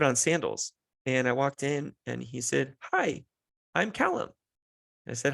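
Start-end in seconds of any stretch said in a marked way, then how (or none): 2.9-4.3 put-on high-pitched little-kid greeting; toddler voice. Toddler introduces himself to a friend's dad, high little-kid voice. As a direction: pipe up like a tiny child.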